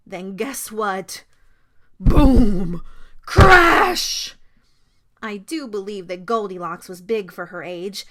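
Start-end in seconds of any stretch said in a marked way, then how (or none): 2.0-4.4 very loud, explosive exclamations; crashing sound effects. A narrator's reading. Narrator telling children the chair broke, explosively. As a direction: boom it out like a breaking chair.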